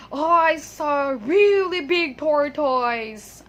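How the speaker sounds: loud, drawn-out quote, tortoise as TOR-toyz; slow mock exclamation with OY in tortoise; imitated mispronunciation. Teacher imitating what people always say, imitated mispronunciation. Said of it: "so they always say".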